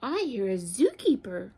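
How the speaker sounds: measured, expressive question and answer; picture-book read-aloud. A narrator's reading. Teacher reads animal story to children, expressive storytelling. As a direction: read it out clearly for small children.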